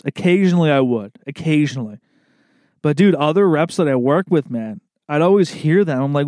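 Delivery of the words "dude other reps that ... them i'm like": ordinary delivery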